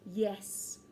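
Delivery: ordinary delivery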